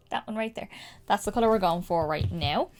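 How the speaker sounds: ordinary talk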